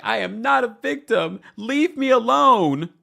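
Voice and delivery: hammed-up tearful storm-off cry; mock upset outburst. Brittany storming off the reunion, mock dramatic outburst. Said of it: "she's like".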